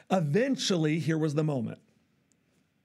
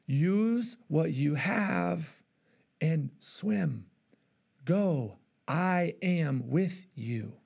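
gentle fatherly reassurance; much slower than usual. Retold or imitated. God reassures drowning believer.

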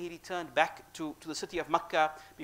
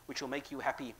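whispered gently, like a secret comfort; tender whisper; 3 semitones lower, 6 dB quieter than usual. Acted. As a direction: whisper the promise softly. God's comforting promise.

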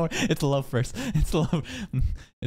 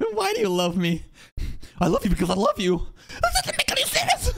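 mock dialogue; faster than usual. Streamer parodying the couple's argument, high squeaky mock. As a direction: squeal the lines like a cartoon.

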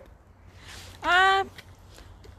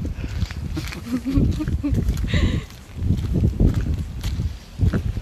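long breathy giggling fit; giddy laughter; 3 semitones lower than usual. Real, not acted. Playing in the snow, long giddy laughter.

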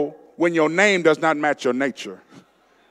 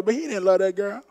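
ordinary delivery

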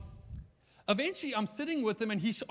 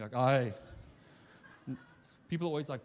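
ordinary delivery

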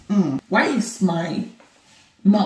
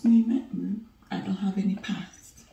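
slow, teasing whisper; mock whisper; 6 dB quieter, slower than usual. Wife teasing her husband about her past, slow mock whisper. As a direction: whisper it like a secret joke. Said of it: "I'm just kidding".

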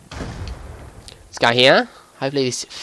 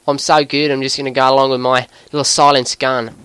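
put-on Aussie gamer commentary; Australian accent. Gamer talking viewers through a match, Australian accent. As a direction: narrate the game in an Australian accent.

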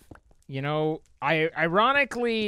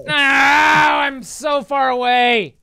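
loud, high, very drawn-out cry; despairing wail; 6 semitones higher, 10 dB louder, much slower than usual. Real, not acted. Gamer stranded far from friends, despairing wail. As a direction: howl it in dismay. Wailing at losing progress.

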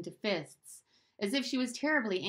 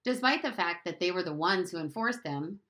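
ordinary delivery

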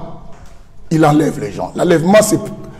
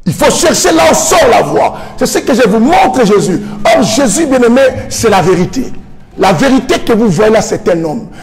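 fiery preaching; 3 semitones higher than usual. Real, not acted. Preacher exhorting the congregation, fiery and rising. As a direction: drive it home to the congregation.

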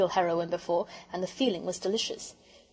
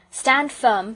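raised, louder voice giving orders; firm command; 4 semitones higher, 7 dB louder than usual. A narrator's reading. Peter to his sisters as the train nears, commanding.

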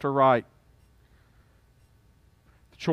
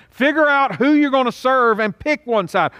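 high, emphatic charge to the crowd; commanding challenge; 10 semitones higher, 4 dB louder than usual. Retold or imitated. Israel is told to choose whom to serve, commanding challenge. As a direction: lay down a high-pitched ultimatum. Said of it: "the people of Israel was told".

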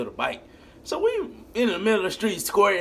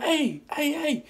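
calling out; 12 semitones higher, slower than usual. Retold or imitated. Men calling out after them.

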